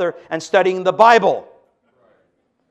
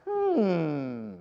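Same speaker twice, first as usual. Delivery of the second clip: quiet pondering hum; thoughtful hum; 9 dB quieter than usual. Pretend. Preacher hums to let the point sink in, quiet thoughtful hum.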